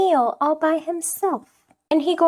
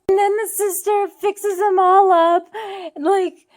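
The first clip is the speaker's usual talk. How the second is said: usual speaking voice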